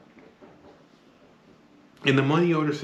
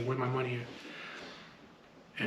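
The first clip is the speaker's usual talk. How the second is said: slow, quiet and threatening; 11 dB quieter, much slower than usual. Retold or imitated. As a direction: drag it out, dead quiet threat. Choking her, demanding money.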